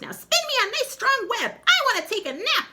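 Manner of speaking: very high, loud bossy witch; shrill witch barking orders; witch commanding. Acted. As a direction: order the new spider to work. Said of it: "said the witch".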